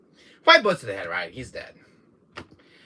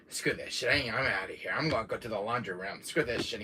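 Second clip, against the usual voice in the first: muttered to himself; 7 semitones lower, 11 dB quieter than usual. Retold or imitated. Fleeing Jack, muttering to himself.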